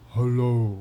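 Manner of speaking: loud put-on deep voice. Shown as a demonstration. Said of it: "very deep".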